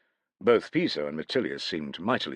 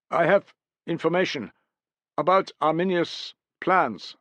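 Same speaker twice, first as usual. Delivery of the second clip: higher, louder foreign warrior; lightly raised warrior voice; 10 semitones higher, 4 dB louder than usual. A narrator's reading. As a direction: voice the eager deserter. Warrior explains his coming.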